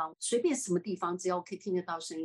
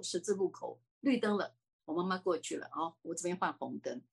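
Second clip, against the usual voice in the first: ordinary talk, nothing marked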